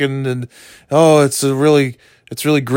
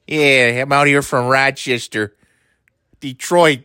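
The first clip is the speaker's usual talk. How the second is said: put-on light Rochester accent, TV chef chirp; a hint of a Rochester accent, upbeat; Rachel Ray Rochester accent; 3 semitones higher, slower than usual. Playing Rachel Ray with a Rochester accent, lightly. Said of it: "That Rochester accent and Detroit".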